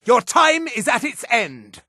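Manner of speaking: high, grandiose proclamations; villainous battle taunts. Acted. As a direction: lord it over a lesser foe. Mage taunts enemy in battle.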